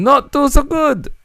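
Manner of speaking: put-on teasing French accent, high and slow; mock French accent. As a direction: squeak it in a silly French accent. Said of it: "the French impersonation".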